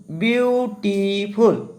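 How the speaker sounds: Beautiful drawn out slowly in three parts, বিউ-টি-ফুল; syllable by syllable. Shown as a demonstration. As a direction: break beautiful into separate, stretched syllables to show each one. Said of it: "দেখা যাচ্ছে কি একাধিক syllable". (Beautiful শব্দটি ধীরে টেনে ভেঙে ভেঙে, বিউ-টি-ফুল)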